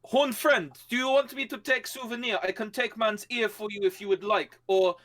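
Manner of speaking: cheerfully menacing in a strange voice; foreign warrior voice. Acted. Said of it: "and I'm like".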